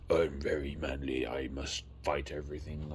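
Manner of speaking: a hint of a booming Asgardian hero; mock manly Thor voice. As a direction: play a pompous warrior god, half-joking. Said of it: "I don't know why I'm doing the accent".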